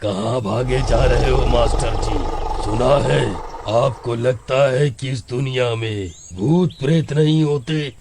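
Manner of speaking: deep, menacing demon taunt; devil voice. Acted. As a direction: toy with him like a devil. (शैतान की गहरी आवाज़)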